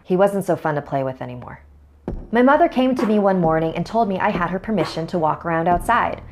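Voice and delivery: ordinary delivery, nothing marked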